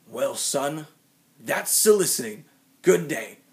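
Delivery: put-on loud, high gruff old man telling off a kid; grumpy old man. Old man at his door shouting at young solicitors, loud and high. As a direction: bark it at the kids like a fed-up old neighbour. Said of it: "leans into the screen door and says".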